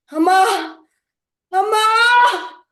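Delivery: loud, high cries building in force; screaming in pain. Acted. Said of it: "she kept screaming".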